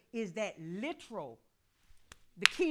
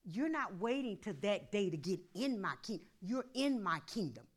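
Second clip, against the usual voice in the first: ordinary delivery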